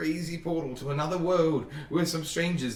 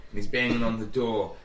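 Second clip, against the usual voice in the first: ordinary talk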